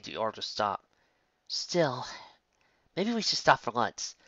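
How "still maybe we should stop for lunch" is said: whispering. A narrator's reading. Character whispering.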